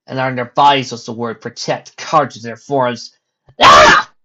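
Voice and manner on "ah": short unvoiced shriek; sudden startled cry; scream. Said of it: "Rainbow Dash screamed".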